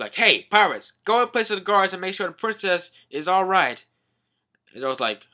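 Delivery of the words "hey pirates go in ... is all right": lightly acted raised order; commanding. King orders pirates to guard the princess, commanding. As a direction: send them off like a royal command.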